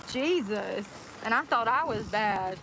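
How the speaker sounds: ordinary talk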